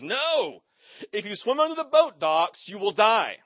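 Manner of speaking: high, loud panicked warning; dramatic warning. Retold or imitated. Mom yelling at her son by the lake, loud alarm. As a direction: cry out in alarm like a frantic mom. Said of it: "I mean, very dramatic".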